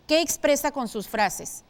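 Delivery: normal talking voice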